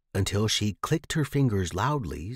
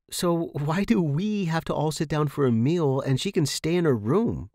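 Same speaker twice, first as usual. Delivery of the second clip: higher, blurted protest; an indignant outburst that slips out; burst out; 6 semitones higher, 3 dB louder than usual. A narrator's reading. Sarah questioning Maria's rule, blurted higher protest. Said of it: "I burst out before I could stop myself".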